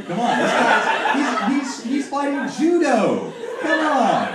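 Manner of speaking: high, loud, slow exasperated laughing rant; disbelief, laughing it out; incredulous. Real, not acted. Comic mocking the judo ban to the crowd, incredulous.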